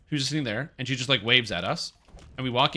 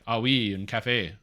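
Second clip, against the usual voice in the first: slow, lightly voiced Quebec French "Ah oui"; light Quebec French accent, unhurried; Quebec French worker. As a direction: answer like a Quebec cashier, a touch drawn out.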